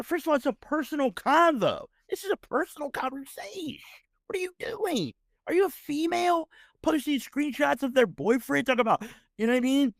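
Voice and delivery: indignant, can't believe what he's seeing; high-pitched exasperated rant; outraged disbelief. Real, not acted. Outraged at screenshot posting.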